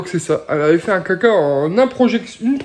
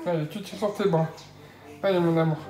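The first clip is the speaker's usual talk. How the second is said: soft coaxing; 4 semitones lower, 9 dB quieter, faster than usual. Real, not acted. Parent encouraging baby on her arms, softly. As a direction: coax her along tenderly.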